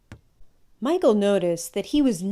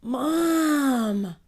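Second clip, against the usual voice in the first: whiny protest; 7 semitones higher than usual. Acted. Son protests mother's rule.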